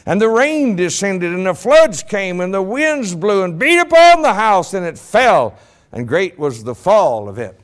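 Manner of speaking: King James scripture proclaimed from the pulpit; preaching scripture. Acted. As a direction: proclaim the Bible verse to the congregation.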